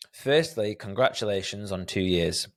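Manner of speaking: ordinary delivery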